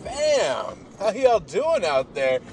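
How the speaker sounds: big drawn-out greeting. Acted. Host greeting his podcast listeners with a long, high, loud welcome.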